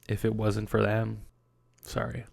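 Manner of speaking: words swallowed by a sob; crying. Real, not acted. Podcaster crediting his parents, breaking down crying.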